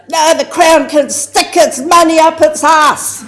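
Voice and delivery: high, loud, scornful outburst. Retold or imitated. Auntie rejecting the Crown's money, loud and scornful. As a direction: throw the insult out loud.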